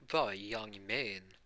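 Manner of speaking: very slow, drawn-out farewell; All Might. Acted. Hero says goodbye to the boy, slow and grand. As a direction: stretch each word of the goodbye. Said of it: "All Might".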